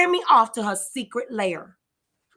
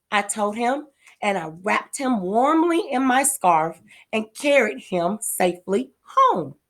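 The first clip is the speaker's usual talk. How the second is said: ordinary delivery, nothing marked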